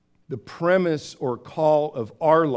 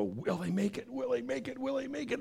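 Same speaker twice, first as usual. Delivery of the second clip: anxious viewer; 9 semitones higher, 11 dB quieter, faster than usual. Retold or imitated. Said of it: "you sit there and go".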